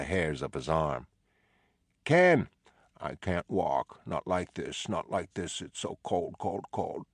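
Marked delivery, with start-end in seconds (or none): 2.0-2.5 sharp call. Acted. Calling a patient who ignores him. 3.0-7.1 lightly voiced disturbed patient. Mentally ill patient Ken speaks.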